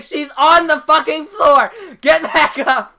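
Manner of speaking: high, very loud mock alarm; full-volume acted warning; panicked retold shout. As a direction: yell it as if sending friends fleeing upstairs.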